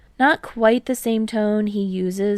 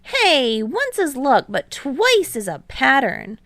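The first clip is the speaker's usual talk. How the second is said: higher and louder, an eager protest; Hinata protesting; 6 semitones higher, 4 dB louder than usual. A narrator's reading. Hinata protests to Kei about his blocks, higher and louder. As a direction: push back fast like a bouncy teammate. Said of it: "Hinata protests".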